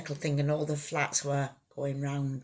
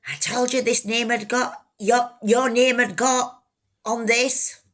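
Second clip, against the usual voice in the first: higher and louder, a threatening yell; retold furious yell; 5 semitones higher, 8 dB louder than usual. Harry shouting threats at her, a retold furious yell.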